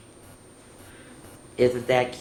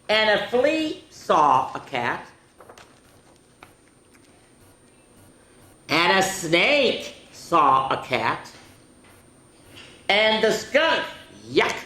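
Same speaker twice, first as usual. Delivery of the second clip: measured, high swoop on each animal name; picture-book read-aloud; 6 semitones higher, 5 dB louder, slower than usual. A narrator's reading. Introducing flea, snake and skunk to children, dramatic storytime voice. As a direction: present each creature with a lifted, drawn-out name.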